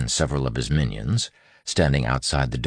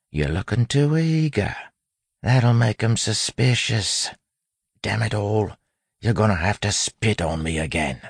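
put-on raised-pitch bandit accent; soft street accent; 8 semitones higher than usual. A narrator's reading. Wayne coaching the bandit to look convincing, soft street accent. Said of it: "Wayne said softly".

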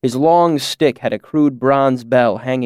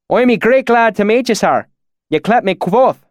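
higher-pitched imitation of rustic valley accent; put-on valley dialect; 10 semitones higher, 4 dB louder than usual. A narrator's reading. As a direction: slip into the swineherd's country dialect. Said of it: "my sudden change in dialect".

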